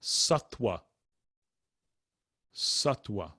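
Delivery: "Sattva" as SUT-wuh, evenly and carefully; uh vowels. Shown as a demonstration. Teacher models next word Sattva, careful pronunciation. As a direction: say "Sattva" with short uh and a w for the v. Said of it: "A's pronounced the same way".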